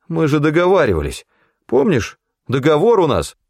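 high pleading crony voice; anxious babbling. A narrator's reading. Федька reminding the hunter of the deal, high and anxious. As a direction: protest nervously, pitched well up. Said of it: "торопливо залопотал Федька".

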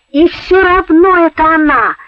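high, loud, insistent; stubborn. A narrator's reading. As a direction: dig in your heels. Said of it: "проявил Петенька несвойственное ему упрямство".

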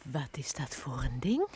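whispered, low and suspicious; wary whisper. Acted. Housekeeper eyeing the dream machine, wary whisper.

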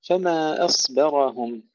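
slow, drawn-out chanted recitation; Quran recitation. Acted. Teacher recites the verse to students, slow tajweed chant.

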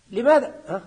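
usual speaking voice

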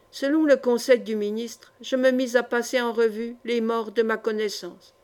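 ordinary delivery, nothing marked

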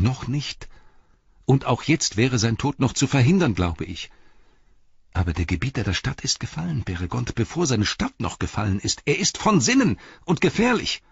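high, insistent hobbit voice; earnest alarm, climbing at the end; urgent Pippin. Acted. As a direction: plead urgently, building to a high alarmed warning.

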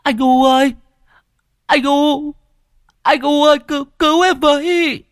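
very high, terrified cry for help; panicked bawling. A narrator's reading. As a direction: wail in panic for your big brother to save you. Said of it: "khóc hoảng hốt tu tu". (khóc hoảng hốt, gào cầu cứu)

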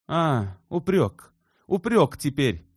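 high, loud, stung outcry; indignant exclamation. A narrator's reading. Shubin seizes on Elena's reproach, exclaiming indignantly. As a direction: throw the word back loudly. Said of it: "воскликнул Шубин".

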